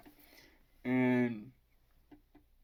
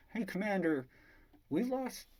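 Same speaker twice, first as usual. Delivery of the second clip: higher-pitched voice of an urgent crew member; crew member reporting; 8 semitones higher than usual. Retold or imitated. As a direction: report to your commander in a raised pitch. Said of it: "someone's like".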